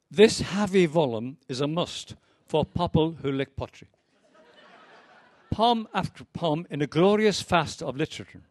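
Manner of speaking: poem read with a theatrical lilt; reading aloud. Acted. Poet reading his parody review to the audience. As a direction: read it as a grand literary pronouncement.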